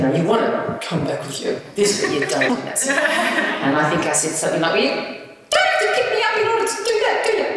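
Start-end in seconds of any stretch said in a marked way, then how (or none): 4.7-7.5 high, pinched small voice; pinched shrill voice. Retold or imitated. Answering the colleague who hugged her, pinched shrill little voice. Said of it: "that pinched, shrill little voice".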